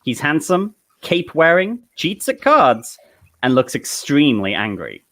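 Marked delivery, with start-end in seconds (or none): none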